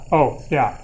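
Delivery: ordinary talk, nothing marked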